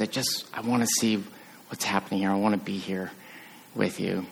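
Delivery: soft, low, intimate longing; tender voice of God. Acted. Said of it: "and says".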